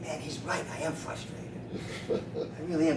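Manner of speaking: very soft, deflated realization; subdued admission. Retold or imitated. Chiropractor privately concedes the man's verdict, quiet admission. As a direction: let it sink in, almost to yourself.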